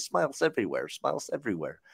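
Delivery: soft Russian-accented imitation. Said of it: "if I put my Russian accent on".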